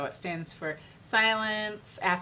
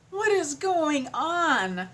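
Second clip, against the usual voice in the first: slow, higher, drawn-out mock bewilderment; exasperated question; 8 semitones higher, much slower than usual. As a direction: stretch it out, baffled. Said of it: "And I was just like".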